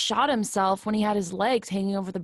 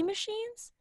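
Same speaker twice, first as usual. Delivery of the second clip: very high, sarcastic "machines?"; mocking squeak; 14 semitones higher, 5 dB quieter than usual. Echoing a friend's mockery, squeaky and sarcastic. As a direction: throw the word back in a squeaky mock.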